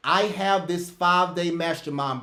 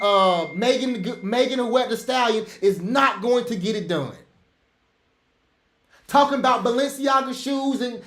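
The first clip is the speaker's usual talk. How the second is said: heated rant; 4 semitones higher than usual. Real, not acted. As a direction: fire them up, shouting the point home. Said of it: "Sorry for that rant".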